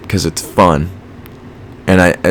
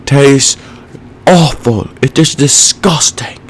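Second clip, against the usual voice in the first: Gordon Ramsay impression; 6 semitones higher, slower than usual. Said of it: "or he's like".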